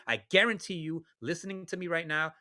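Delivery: ordinary talk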